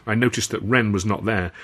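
usual speaking voice

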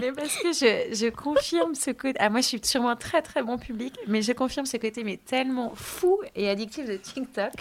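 can't stop laughing as she speaks; laughing while talking. Real, not acted. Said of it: "est morte de rire, elle pleure de rire".

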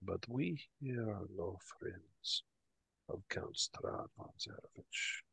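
slow, hushed confiding whisper. Acted. Barkeep disowns Count Strahd to visitors, in a secretive whisper. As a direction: confide it under your breath, taking your time. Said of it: "he lowers down his voice a little bit".

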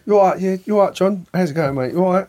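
matey Cockney geezer small talk; geezer London accent. Retold or imitated. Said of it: "it's accent straight away".

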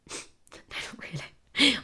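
near-whispered admission of not being OK; tiny whispered retort; hushed, shaken reply. Retold or imitated. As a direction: breathe it out almost without voice. Retelling weak answer to nan.

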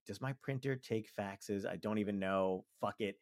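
slow, soft pondering, then giving up; hushed private doubt; inner thought. Acted. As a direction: think it through under your breath. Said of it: "and in my head".

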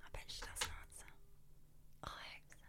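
hushed, voiceless whisper. Real, not acted. Prankster to co-host as the call rings, whispering. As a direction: whisper while the phone rings.

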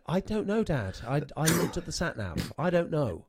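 put-on high, whiny teenage reply; a touch of a fed-up kid; exasperated teen. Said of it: "and you're like".

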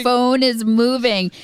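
sudden rising excitement, slowed; excited. Real, not acted. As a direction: stretch it out, thrilled and anxious. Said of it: "It is so exciting".

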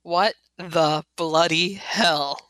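slow, drawn-out bewildered outburst; baffled Misfits. Acted. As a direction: spell out the confusion, slowly. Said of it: "Say the Misfits".

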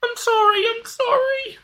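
very high, squeaky apology; guilty dog voice. Pretend. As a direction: whine it like a shamed puppy. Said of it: "that kind of thing where it's sort of".

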